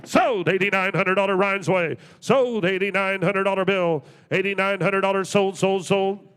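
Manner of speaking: calling out the final sale; auctioneer calling sold. Acted.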